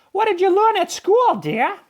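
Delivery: high, tender mom question; mother voice. A narrator's reading. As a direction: ask your son kindly. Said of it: "Clark's mother asked".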